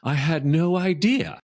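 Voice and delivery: mock the boss's shocked reply; aghast. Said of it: "he looked at me aghast".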